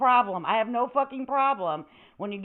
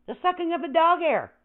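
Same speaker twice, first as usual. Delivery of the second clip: "dog" lifted high on the repeat; higher repeat; 8 semitones higher than usual. Shown as a demonstration. To chat about vacuuming, a higher mock repeat. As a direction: say it again, pitched up on "dog". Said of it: "repeat with higher pitch".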